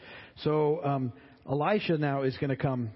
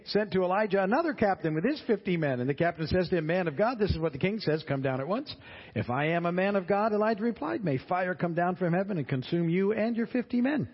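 scripture reading; 5 semitones higher than usual. Acted. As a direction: read the passage out to the class. Reads scripture between comments.

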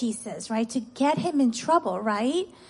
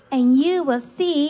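put-on raised, emphatic lead-in for children to echo; verse to repeat; 3 semitones higher, 4 dB louder than usual. Shown as a demonstration. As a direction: call it out for kids to repeat. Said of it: "Okay, repeat after me".

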